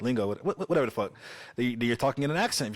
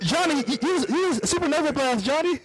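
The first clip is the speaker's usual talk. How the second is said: cartoon hero yelling for Johnny's supernova blast; mock hero battle cry. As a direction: cheer on the Human Torch mid-battle. Acting out the Galactus fight.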